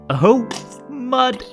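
ordinary delivery, nothing marked

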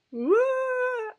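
high, drawn-out amazed whoa. Retold or imitated. Reacting to her own newsletter, with an amazed whoa. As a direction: gasp it out in wonder. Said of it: "and I just was like".

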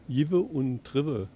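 "Jive und Trive" said slowly and deliberately, each word drawn out; dialect pronunciation demo. Shown as a demonstration. Local guide demonstrating the dialect to the viewer, slow careful "Jive und Trive". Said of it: "wird die auch so gesprochen".

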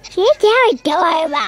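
high, loud strange monster voice. Real, not acted. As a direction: voice suddenly jumps into a monster squeal. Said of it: "what happened to my voice right there".

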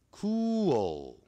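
"cool" drawn out, high and falling, with a dark L at the end; careful model pronunciation. Shown as a demonstration. Teacher modelling a word for students, careful pronunciation. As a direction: stretch "cool" into koo-ol, tongue tip up. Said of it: "不要念成cool啊".

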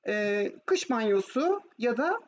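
ordinary talk, nothing marked

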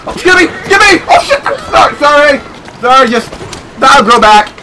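very high, loud yelps; panicked shouting. Real, not acted. Player shouting sorry at people he runs into, very high and loud. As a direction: shriek apologies mid-crash. Crashing into people while driving.

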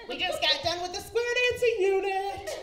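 high, loud, sing-song cheer; perky gym teacher. Retold or imitated. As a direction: bounce it like an overjoyed gym teacher. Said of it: "just their whistle swinging".

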